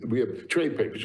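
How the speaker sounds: ordinary talk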